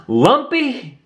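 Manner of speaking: high alarmed call of a name; crying out. Acted. Roo sees Lumpy slip down the slope, high alarmed cry. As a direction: call out as a friend falls. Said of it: "cried Roo".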